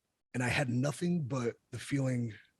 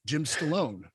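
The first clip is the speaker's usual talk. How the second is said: fake introduction; 6 semitones higher, 10 dB louder, slower than usual. Introduces himself as fake son.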